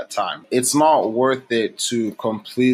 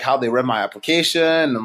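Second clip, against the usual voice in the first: ordinary talk, nothing marked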